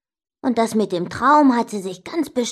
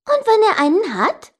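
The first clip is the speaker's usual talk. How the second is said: high-pitched, crafty asking; sly question; 11 semitones higher, 4 dB louder than usual. A narrator's reading. Sister baits brother over the bet, sly high question. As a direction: ask it high, setting a trap. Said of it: "fragte sie listig".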